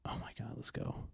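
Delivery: hushed excited whisper. Real, not acted. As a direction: breathe it out, barely voiced.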